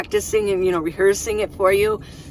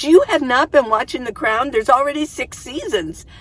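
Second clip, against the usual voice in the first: higher, louder mock astonishment; incredulous daughter; 5 semitones higher, 4 dB louder than usual. Daughter to mom about a missed show, loud incredulous imitation. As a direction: imitate a daughter scolding in disbelief.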